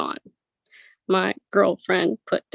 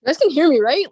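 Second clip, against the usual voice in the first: louder and higher check-in; 7 semitones higher, 7 dB louder, faster than usual. Real, not acted. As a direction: call out to be heard. Checking the audio over the call.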